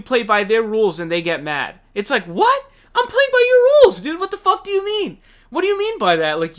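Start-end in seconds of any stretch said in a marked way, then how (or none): 2.2-6.4 much higher and louder, an incredulous yell; mock indignant outburst. Voicing Bernie snapping back at the DNC, in a high, loud, incredulous yell. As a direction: play the wronged man yelling in disbelief.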